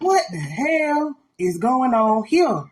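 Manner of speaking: very slow, baffled mock reaction; drawn-out disbelief. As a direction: stretch every word in comic disbelief. Quotes own baffled reaction, drawn out.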